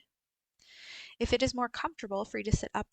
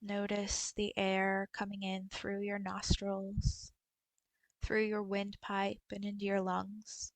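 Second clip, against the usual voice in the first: guided meditation. Acted. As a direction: guide them gently through a body scan, unhurried. Leads a body scan meditation.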